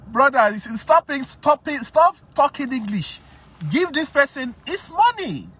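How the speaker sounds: high, loud shouting, stop it; a man shouting down a debtor who keeps stalling; shouting at him. Retold or imitated. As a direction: yell at him to stop and pay up. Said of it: "I was saying".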